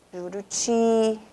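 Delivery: Juruti with a long final TI; drawn-out stressed syllable. Shown as a demonstration. Teacher showing pupils the tonic syllable, Juruti held on TI. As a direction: say Juruti slowly, holding TI.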